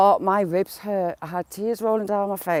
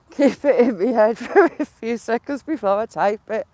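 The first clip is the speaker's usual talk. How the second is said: laughing while talking; 2 semitones higher, 6 dB louder than usual. Real, not acted. Laughing through the sentence.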